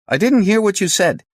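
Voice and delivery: high, loud phone voice. Acted. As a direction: keep the voice up after shouting. Caller after bad line.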